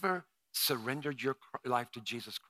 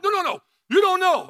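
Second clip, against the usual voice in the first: much higher, loud protest; insistent shout; 15 dB louder, faster than usual. Retold or imitated. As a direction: shout him down, no joke. Retold himself protesting to Rick.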